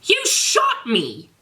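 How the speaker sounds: high, slow outraged whine; outraged Draco. Acted. Draco tells Garfield he was shot, high slow whine. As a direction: drag out the accusation, squeaky and indignant.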